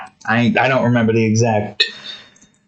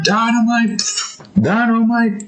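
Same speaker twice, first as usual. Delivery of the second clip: eerie calling voice; 10 semitones higher than usual. Retold or imitated. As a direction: summon him from the coma. Summoning him back.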